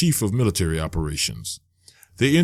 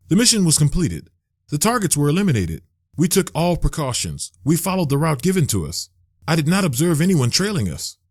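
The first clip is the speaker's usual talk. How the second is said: crisp, higher female voice, insistent; firm woman's voice; 9 semitones higher than usual. A narrator's reading. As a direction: cut in and insist, clipped facts. Zarita jumps in firmly.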